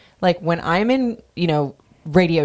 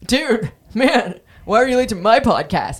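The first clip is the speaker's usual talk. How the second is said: a higher, lightly mocking dude voice; imitating Sandoval; 7 semitones higher than usual. Host imitating Sandoval scolding Nick Viall, mild mock whine. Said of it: "Sandoval was just like".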